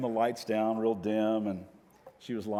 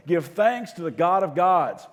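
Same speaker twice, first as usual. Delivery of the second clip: loud, raised, slow call to the congregation; full-voice reading; 6 semitones higher, 8 dB louder than usual. Shown as a demonstration. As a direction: lead the crowd in a rousing chant. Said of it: "we're really singing full voice".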